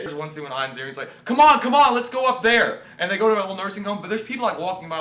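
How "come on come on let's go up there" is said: high, loud rallying call; hammed-up urgent command; urgent mock shout. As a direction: hurry everyone along like an action hero. Acting movie hero urging others.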